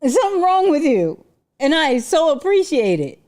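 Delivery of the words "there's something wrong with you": loud, high, laughing rant; laughing mock outrage. Real, not acted. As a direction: roast him while cracking up.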